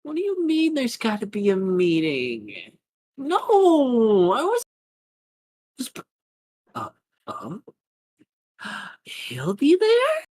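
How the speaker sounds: high chirpy fake-cheerful voice; sweet airhead on the phone; bubbly villain. Villain on a call stone.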